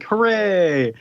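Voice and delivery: high, drawn-out hooray; loud singsong cheer; mock cheer. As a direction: cheer like a whole crowd, hammed up. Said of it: "everyone's like".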